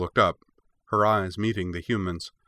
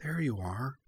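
soft, higher, tender murmur; said softly; 5 semitones higher, 8 dB quieter than usual. A narrator's reading. Cora hugs the bear dog pup, softly. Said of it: "said Cora softly".

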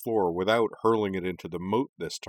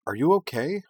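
higher, louder anxious question; worried check; 8 semitones higher, 5 dB louder than usual. A narrator's reading. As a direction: urgently check on a hurt friend. Teammate checks on burned friend.

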